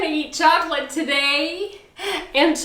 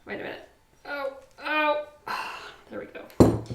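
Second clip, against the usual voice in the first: usual speaking voice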